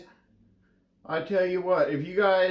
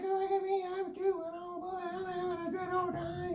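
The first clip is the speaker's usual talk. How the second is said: much higher and softer, giddy little voice; Steuben's happy voice; 12 semitones higher, 9 dB quieter than usual. Retold or imitated. Voicing Steuben bragging on his favorite ride, high and soft. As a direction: chirp it like a tiny delighted toy. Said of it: "He's like".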